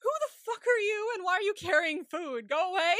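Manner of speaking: high, loud indignant shout at the stranger; retelling yelling at the caretaker on TV, mock furious shout; mock outraged yell. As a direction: yell him out of the house, half laughing. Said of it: "I was like".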